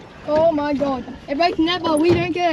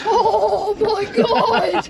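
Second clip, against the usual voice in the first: drawn-out laughing exclamation; excited laughing shout; 5 semitones higher, much slower than usual. Real, not acted. Huge fish just landed.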